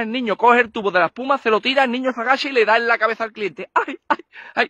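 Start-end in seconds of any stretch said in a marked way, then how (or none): 3.7-4.7 pained cry. Acted. Client jabbed again.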